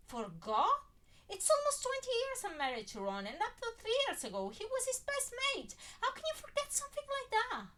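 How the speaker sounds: high, loud disbelieving cry; rising up loud in disbelief; incredulous outburst. Acted. Wife stunned that Harry forgot her husband, loud disbelief.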